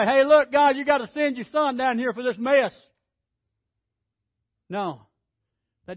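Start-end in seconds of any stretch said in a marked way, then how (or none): 0.0-2.7 loud, high, brash call up to heaven; hammed-up bossy plea, raised up high; mock demand to God. David calling up to heaven, brash and loud.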